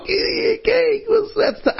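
very high, crying amazement; amazed cry. Acted. The class sees the cake and cries out amazed. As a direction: gasp it out in disbelief. Children see three-tier cake.

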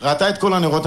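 usual speaking voice

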